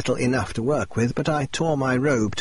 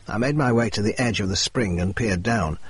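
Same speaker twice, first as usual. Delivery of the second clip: ordinary delivery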